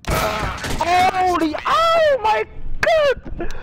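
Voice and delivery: very high, loud, drawn-out shriek; excited yell. Real, not acted. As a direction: scream it out in sheer excitement. Big moment in the game.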